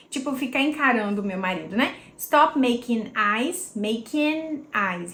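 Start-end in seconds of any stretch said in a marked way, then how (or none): 2.2-5.1 "making eyes" without the g, the n running straight into "eyes"; slow it down and link the words; linked, slow demo. Shown as a demonstration. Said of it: "eu não falo esse G e esse N se conecta". ("making eyes" sem o G, N ligado ao "eyes")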